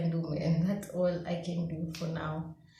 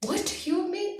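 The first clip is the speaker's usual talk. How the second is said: indignant; 12 semitones higher than usual. Retold or imitated. Mad at God after exam.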